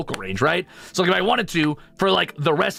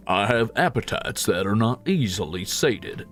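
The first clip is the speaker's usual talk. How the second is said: lower-pitched Southern gentility; old-style Southern accent; 5 semitones lower than usual. Shown as a demonstration. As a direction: put on a theatrical Southern accent. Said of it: "Are you talking like that sort of like".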